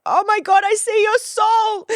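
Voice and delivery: awestruck, almost swooning; gushing awe. Pretend. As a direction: gasp it out in overwhelmed adoration. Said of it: "You're just like".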